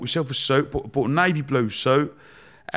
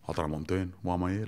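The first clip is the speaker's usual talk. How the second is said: quiet, low self-questioning; lost, private thought; 6 semitones lower, 10 dB quieter, much faster than usual. Retold or imitated. Clueless project manager to himself, quiet and low. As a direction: drop it right down, as if to yourself.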